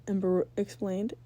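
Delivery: ordinary delivery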